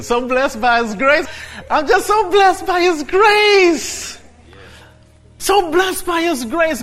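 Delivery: high, loud cry of praise; full-force preaching. Real, not acted. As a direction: cry it out with drawn-out fervour.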